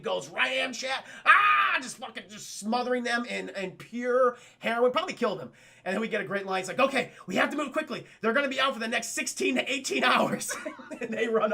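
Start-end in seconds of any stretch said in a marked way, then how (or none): 1.2-1.8 high, loud mock battle cry; attacking yell. Attacker lunging in retold film. 6.8-10.5 mock urgency. Urgent line acted from the film.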